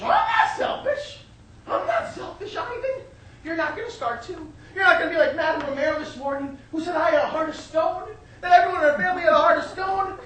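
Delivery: high-pitched wounded protest; affronted mother. Acted. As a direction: squeal the denial, then scold your son. Mother protests son's accusation.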